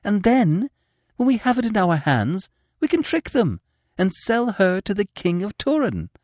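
high, intense, urgent persuasion; passionate pleading. Acted. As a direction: tempt him with passionate urgency. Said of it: "she argued passionately".

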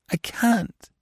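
a tearful high break on the words; high sobbing cry. A narrator's reading. Blanche sobs to Orth, refusing to stay, voice breaking high. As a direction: let the voice crack into a sob. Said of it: "she sobbed".

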